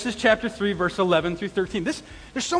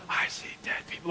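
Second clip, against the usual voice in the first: breathy spooky whisper of the movie line; hushed eerie Sixth Sense quote; 11 dB quieter than usual. Retold or imitated. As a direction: whisper it like the creepy kid. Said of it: "The Sixth Sense".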